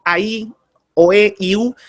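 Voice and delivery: "A, I, O, E, I, U" each vowel said apart and clear; vowels spelled out. Shown as a demonstration. Teacher to announcers, showing the vowels of diphthongs one by one. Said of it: "las uniones de dos vocales".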